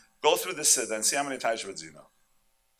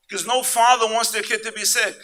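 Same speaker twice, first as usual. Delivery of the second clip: loud, high fervent sermon voice; preaching; 8 semitones higher, 8 dB louder than usual. Acted. Rabbi preaching to the congregation, fervent and loud. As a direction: drive it home to the crowd.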